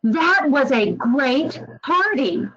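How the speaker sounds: slow, bright and genuinely delighted; excited. Shown as a demonstration. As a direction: really sell how great it was. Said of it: "you can tell the difference there".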